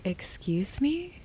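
high breathless whisper of disbelief; stunned whisper. Retold or imitated. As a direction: whisper "Excuse me?" in shock. Shocked retort to the rude question.

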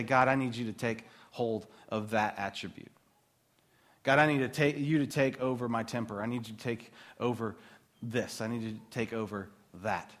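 hushed sincere prayer; quiet prayer. Shown as a demonstration. Modelling a prayer.

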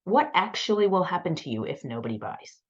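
usual speaking voice

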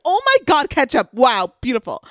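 high, loud hammed-up gushing; mock amazement. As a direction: gush loudly over something trivial, mocking her. Mocks her gushing over ketchup.

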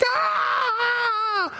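very high, long mock scream; crowd scream. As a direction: ecstatic game-show squeal. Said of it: "people are like".

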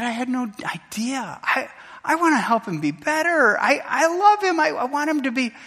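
high, pleading retelling of a tearful dad; acted tearful protest of love; imitated sobbing plea. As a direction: plead upward, as if close to sobs. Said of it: "and he just sobbed".

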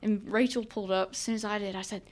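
ordinary delivery

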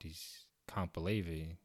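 usual speaking voice

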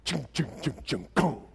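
softly voiced mechanical chung-chung; quiet, rhythmic machine noises; imitated ratcheting clanks. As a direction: mimic the safety bar clunking into place. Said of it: "and you hear this".

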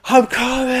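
high, strained yell while running; retold shouting. Runner vlogging his sprint to the gate, mock desperate shout. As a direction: holler ahead to hold the plane, acted out. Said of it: "I'm shouting".

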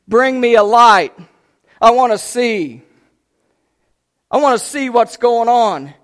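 loud, high shouted demand; calling out. Acted. Jailer calling for a light in the dark, calling out. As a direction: holler for it across a dark prison. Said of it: "You know what he called for?".

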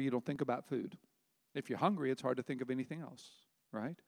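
ordinary talk

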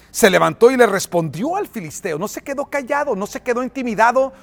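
loud, high, impassioned sermon delivery; full-force preaching. Real, not acted. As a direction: preach David's defiant answer with rising fire. Preaches David's answer at full force.

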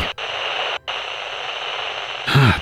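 long, soft sigh; resigned sigh. A narrator's reading. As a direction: let out a slow, quiet breath. No reply on the radio.

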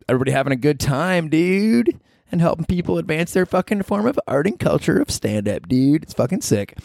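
high excited bro pitch; high hammed-up bro; bro hype. Hyped bro plug.

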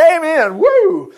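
loud, high-pitched jubilant cheer; shouted amen. Acted. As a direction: whoop it out like a rejoicing congregation.

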